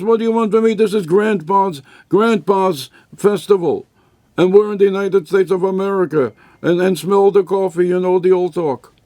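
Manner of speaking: put-on a bored kid grumbling at dad; complaining kid. Kid complains about grandpa's holiday.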